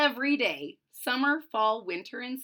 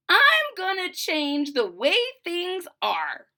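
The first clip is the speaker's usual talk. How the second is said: slow, loud, higher resolve; defiant daughter; 7 semitones higher, 6 dB louder, slower than usual. Acted. Daughter vowing change after father leaves, slow and loud. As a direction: declare it with determination.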